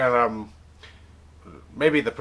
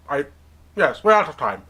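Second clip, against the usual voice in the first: ordinary talk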